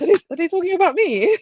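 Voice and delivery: high, quick flustered question. Retold or imitated. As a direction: rush it in nervous surprise. Her worried inner thought.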